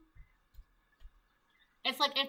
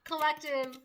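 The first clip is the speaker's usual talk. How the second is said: higher mock plea, drawn out; pleading masculine; 4 semitones higher, 3 dB quieter, slower than usual. Karmic masculine begs the collective, mock pleading. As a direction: whine a hammed-up plea. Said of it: "now you have this karmic masculine".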